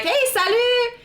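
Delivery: high, eager burst of French, quickening at the end; excited French greeting. Retold or imitated. Greeting French speakers in a Tokyo bar, high and excited. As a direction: greet the strangers like long-lost friends. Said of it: "and I'm like".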